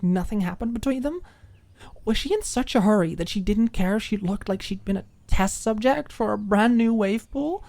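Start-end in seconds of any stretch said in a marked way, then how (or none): none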